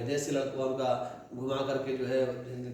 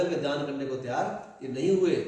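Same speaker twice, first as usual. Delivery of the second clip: ordinary delivery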